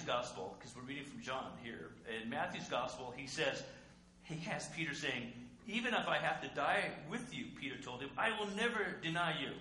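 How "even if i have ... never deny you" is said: loud boast. Acted. Preacher voicing Peter's boast.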